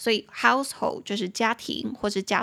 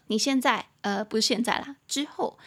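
ordinary talk

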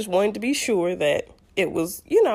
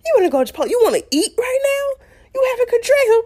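loud, high-pitched incredulous outburst; imitated husband's scandalized tirade; going off; 5 dB louder than usual. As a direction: blow up in disbelief, voice shooting up. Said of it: "just goes off".

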